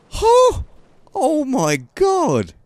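high squeaky surprised huh; stunned disbelief. Real, not acted. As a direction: gasp it out in disbelief. Reacting to crash.

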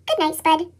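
usual speaking voice

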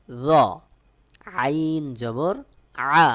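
loud, measured sounding-out of letters; letter drill recitation. Shown as a demonstration. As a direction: call out each letter like a teacher drilling a class. Teacher drills Arabic letter sounds.

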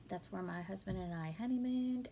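ordinary talk

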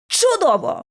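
high-pitched exasperated yelp; yelled exclamation. A narrator's reading. Narrator on hearing the key was left in the lock, yelling. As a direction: exclaim it with bitter sarcasm. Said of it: "зіпнула я".

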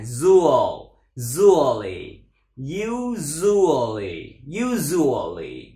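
"usually" with a long buzzing zh in the middle; ZH sound. Shown as a demonstration. Teacher drilling learners, "usually" drawn out on the zh. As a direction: slowly stretch "usually" on a voiced zh. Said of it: "Chữ giờ ha".

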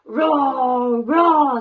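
loud, drawn-out playful roar; dinosaur roar. Acted. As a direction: roar like a friendly dinosaur for kids. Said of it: "said the dinosaur".

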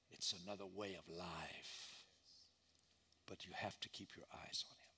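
soft whispered urging. Real, not acted. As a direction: lean in and whisper it. Intimate plea to congregation.

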